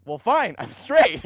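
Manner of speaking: loud, emphatic mock announcement; punchy, loud retold comeback; retold blurted declaration. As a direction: declare it as an instant, easy fix. Retells kid's instant declaration.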